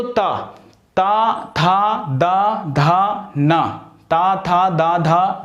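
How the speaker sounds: ता था दा धा ना with the tongue touching the teeth; ta-varga demo. Shown as a demonstration. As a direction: recite ता था दा धा ना slowly, tongue on teeth. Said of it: "ता वर्ग आप पूरा बोलिए".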